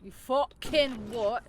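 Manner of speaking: a mock English lad's retort; hammed-up English accent; obnoxious English accent. Shown as a demonstration. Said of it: "obnoxious English accent".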